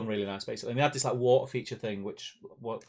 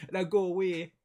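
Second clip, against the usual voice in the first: mock Jamaican; 5 semitones higher than usual. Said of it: "Jamaican at the end".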